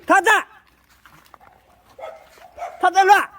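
loud call to the dog. Real, not acted. Owner calling his dog loudly. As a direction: call the dog's name across the yard.

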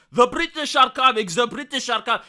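mock rider's warning call.

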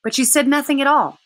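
usual speaking voice

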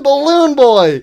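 ordinary delivery

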